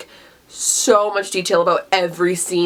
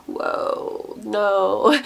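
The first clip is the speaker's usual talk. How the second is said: breathless whispered whoa; stunned whisper. Retold or imitated. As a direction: hush it out in shock. Shocked by Jordan twist.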